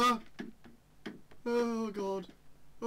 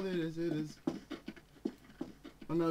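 normal talking voice

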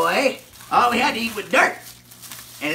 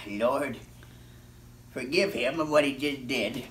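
quiet prayer; 11 semitones lower, 8 dB quieter than usual. Acted. Prayer for forgiveness.

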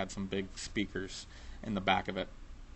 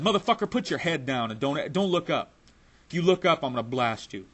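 retold angry threat; 5 semitones higher, 4 dB louder than usual. Angry robber threatens him.

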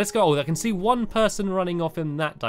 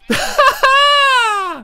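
long, high, loud shrieking laugh; shriek-laugh out of sheer fright; piercing burst of scared laughter; frightened shriek; 11 dB louder than usual. Real, not acted. Scare in game, then relief.

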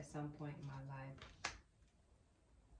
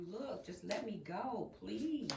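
pleading; 3 semitones higher, 5 dB louder, much slower than usual. Retold or imitated. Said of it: "They're like".

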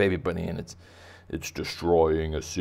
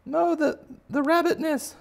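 very high, loud mock shriek; mock panic wail; 6 dB louder than usual. Crowd fleeing the giant bunny, loud high wail. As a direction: cry out in hammed-up horror.

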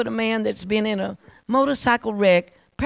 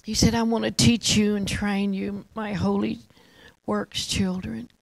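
reverent, with long awed pauses; hushed worship prayer. Real, not acted. Preacher invites listeners to honor God, hushed reverent prayer. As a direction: lead the room gently into worship, unhurried and subdued.

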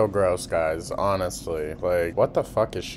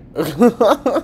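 loud, higher-pitched burst of laughter; real loud laugh; 10 dB louder than usual. Real, not acted. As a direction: burst out laughing at your own jab. Laughs at own harsh remark.

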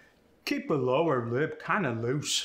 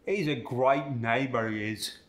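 great and neighbor with an I sound, Cockney style; Cockney accent. Shown as a demonstration. Student demos the Cockney long A on great neighbor. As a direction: say it like a Londoner, great as "grite". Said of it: "get the I sound".

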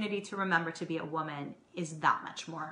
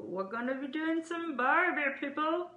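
playful mock Australian accent, much higher; mock Aussie accent; 8 semitones higher than usual. Host joking about barbecue with Adam, mock Australian accent. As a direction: ham up an Aussie twang on Barbie. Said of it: "I don't know what kind of accent that is".

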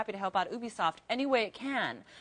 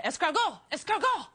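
higher, louder mock French battle cry; 7 semitones higher, 4 dB louder than usual. Mocking French publishers rallying against EA, hammed-up French shout. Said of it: "I think they're gonna say".

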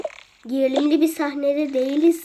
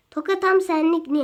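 normal talking voice

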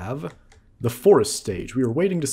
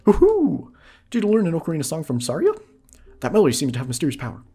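higher, louder, fast cheer; fast, high excited fairy cheer; excited NPC; 5 semitones higher, 5 dB louder than usual. Acted. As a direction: burst in with delight. Voicing NPC reaction.

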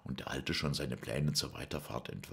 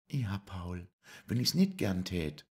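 high, louder voice; Lisei's voice; 7 semitones higher, 4 dB louder than usual. Acted. Lisei tells Paul she loves puppetry, high louder voice. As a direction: answer high and firmly.

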